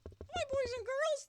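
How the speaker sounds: high squeaky puppet greeting; chicken puppet voice. Pretend. Teacher voicing her chicken puppet for the kids, high and squeaky. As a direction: pipe up like a tiny chick saying hi.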